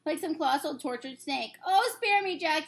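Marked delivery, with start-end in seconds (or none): 1.7-2.6 high, loud pleading yell. Acted. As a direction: yell for mercy. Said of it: "he yelled".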